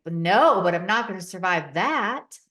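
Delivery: loud, frantic protest; panicked refusal, loud. Retold or imitated. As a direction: plead in a panic not to die. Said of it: "I'm panicked".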